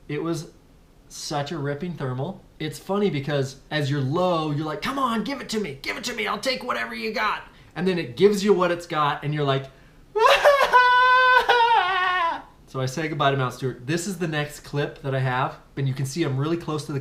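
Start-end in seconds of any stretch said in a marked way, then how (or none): 4.8-7.4 eager begging. Retold or imitated. Begging the thermal for lift. 10.1-12.4 a high, loud shouted laugh; a whooping yell of terror and delight; wild whooping shout-laugh. Retold or imitated. Reacting to the violent thermal.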